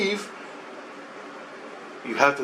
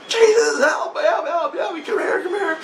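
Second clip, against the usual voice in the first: desperate cry for help. Retold or imitated. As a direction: call out to Jesus, half laughing as you relive it. Retold calling to Jesus for help.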